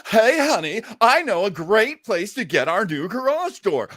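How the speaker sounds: lightly hammed-up cheery ad voice; a touch of a peppy commercial wife; radio ad couple. Mocking a radio ad, a lightly hammed-up cheery wife. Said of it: "and now I hear, like".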